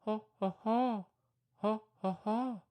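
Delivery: short ha ha monkey hoots; monkey call. Pretend. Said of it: "you just do a little sound".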